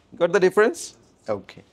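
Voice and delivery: ordinary delivery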